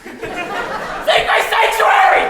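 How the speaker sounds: very high, loud, slow shriek; hysterical pleading. Acted. Pigs beg their neighbor hysterically. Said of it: "they implored, on the verge of hysteria".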